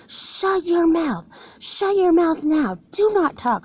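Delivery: high, very slow furious command; angry boss yelling. Retold or imitated. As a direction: bark the order down at him, slowly. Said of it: "and he said".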